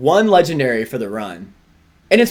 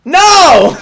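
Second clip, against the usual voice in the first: loud no; 10 dB louder than usual. Real, not acted. Playful protest over Zacian.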